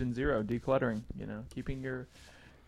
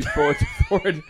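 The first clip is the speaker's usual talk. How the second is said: laughing; 4 semitones higher, 8 dB louder than usual. Real, not acted. Joking with a laugh.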